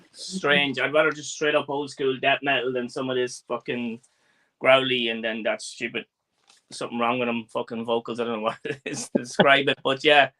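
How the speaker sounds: usual speaking voice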